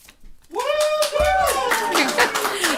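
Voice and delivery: sudden high-pitched giggle; high burst of laughter. Real, not acted. Laughs at winner's name.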